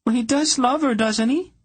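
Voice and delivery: very high, tearful and insistent; tearful demand. A narrator's reading. Princess demanding an answer from Polgara, tearful. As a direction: press her for the truth through tears. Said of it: "Tenedra demanded".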